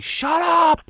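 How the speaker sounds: high, loud Brooklyn shout out the window; Jake LaMotta yelling. Retold or imitated. LaMotta yelling up at noisy neighbors, loud shout. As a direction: bellow at the noisy neighbors like a tough boxer. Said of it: "see how Robert De Niro in Raging Bull, Jake LaMotta".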